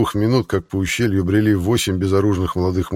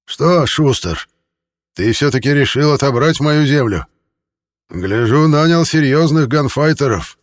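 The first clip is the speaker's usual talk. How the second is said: higher, louder hoarse threat; 6 semitones higher, 5 dB louder than usual. A narrator's reading. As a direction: rasp out a loud warning. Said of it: "сиплый голос ответил". (сиплый угрожающий голос)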